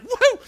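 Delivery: high, loud whoop of joy; imitated gleeful "woo"; excited whoop. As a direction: whoop like someone thrilled to be walking. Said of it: "He's excited".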